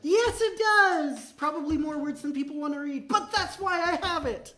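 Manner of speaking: high, eager and proud; bubbling over with pride; excited. Real, not acted. Proud of his readme.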